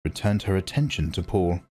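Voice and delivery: ordinary delivery, nothing marked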